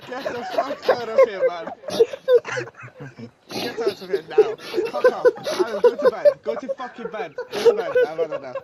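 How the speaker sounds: loud laughing yells; loud fake fury at a bad joke; mock outrage. Real, not acted. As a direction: shout them off the stage in jest. Listener mock-ejects teller after punchline.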